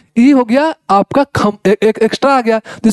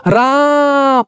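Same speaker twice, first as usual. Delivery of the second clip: राम stretched and raised, a call; 4 semitones higher, much slower than usual. Shown as a demonstration. Teacher demonstrating a far call of राम. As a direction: pull out the आ of राम as you call. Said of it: "जब बुला रहे हैं किसी को".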